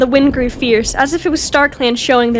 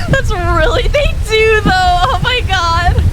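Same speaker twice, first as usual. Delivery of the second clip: high, laughing words; 9 semitones higher, 3 dB louder than usual. Real, not acted. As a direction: talk through the giggles. Still laughing at the joke.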